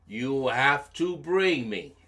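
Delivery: usual speaking voice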